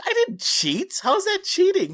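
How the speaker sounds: high, loud retold protest; mock-outraged, voice shooting up; indignant protest. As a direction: squawk back at an unfair accusation. Retold protest at cheating accusation.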